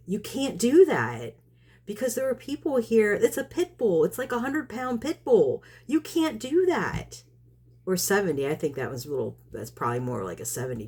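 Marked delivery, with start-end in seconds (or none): 0.1-7.2 high, insistent pleading protest; retold exasperation. Woman explains to neighbour about the stray dog, high exasperated protest. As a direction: climb in pitch, stretching the final refusal.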